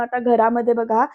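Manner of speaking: ordinary talk, nothing marked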